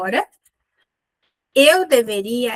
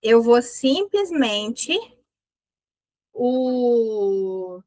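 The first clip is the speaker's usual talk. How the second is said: ordinary delivery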